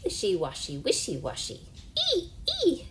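high squeals on the ee; pig squealing. Acted. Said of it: "the pig squeals".

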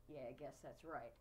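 put-on boy giving in to a friend, dejected. A narrator's reading. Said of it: "Henry answered dejectedly".

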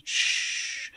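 soft, unvoiced shush; a long, quiet shh; hushing shh. Hushing a sufferer who feels sick, soft shush.

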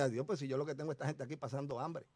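a low aside under the breath; quiet inner thought. Retold or imitated. Preacher recalling his hungry congregation's thought, softly. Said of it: "Nos miramos así".

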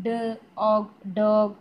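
ഡ്, pause, ഓഗ്, then whole ഡോഗ്; sounding out. Shown as a demonstration. Teacher reminding children to learn by sound, sounding out ഡോഗ്. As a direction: slowly split ഡോഗ് into its sounds, then blend. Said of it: "ഈ sound പറഞ്ഞ് തന്നെ".

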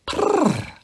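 high rolled purring; cat purr. Acted. The cat stalking round the table, a high purr.

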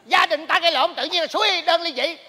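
indignant scolding. Acted. Neighbour objecting to divorce talk, indignant. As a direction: protest loudly at the bad advice.

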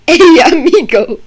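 laughing hammed-up Spanish accent greeting; mock Spanish accent. Patient greeting doctor in joking Spanish accent. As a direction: toss off amigo in a playful Spanish accent. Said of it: "put Spanish into it".

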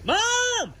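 high, drawn-out holler; long high-pitched summons; calling for mom. Real, not acted. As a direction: call high to someone out of sight.

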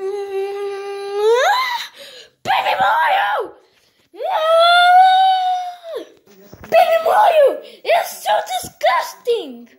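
put-on very high, loud yelling for Baby Mario; panicked screaming. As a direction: scream his name in disgusted panic.

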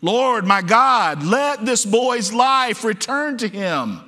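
loud, high, drawn-out desperate plea; impassioned shouted prayer; exclaimed cry to God. Acted. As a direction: cry out slowly at full force. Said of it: "You see that exclamation point?".

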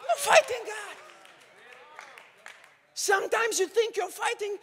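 ordinary delivery

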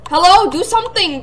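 ordinary talk

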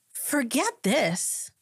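high, slow, drawn-out dismissal; fed up. Retold or imitated. Driving off from church, slow high fed-up dismissal. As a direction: drag out the disgust. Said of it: "I said".

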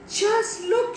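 high, alarmed cry; horrified croak. A narrator's reading. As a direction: urge him to look, aghast. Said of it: "she croaked".

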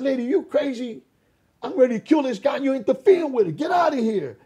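high, loud and furious; yelling at the little lady to get out; angry shout. Retold or imitated. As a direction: bark her away from the fight. Threatening man shouts at old lady.

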